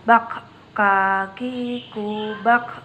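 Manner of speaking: slow, breathy kaki kubak with air let out on the k; slow recitation, audible airflow on the k; breath flowing out. Shown as a demonstration. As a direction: stretch "Kaki kubak" out slowly, letting air flow after each k. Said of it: "Perhatikan ada nafas atau udara yang mengalir".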